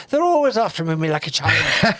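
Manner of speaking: loud-ish, cartoonish Irish accent. Shown as a demonstration. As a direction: start broad with a stock Irish accent. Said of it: "to do the Irish".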